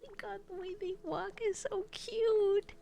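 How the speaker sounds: high, squealing coo; high-pitched fawning; gushing over cuteness. Real, not acted. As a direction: melt over something adorable. Gushing over cute animals.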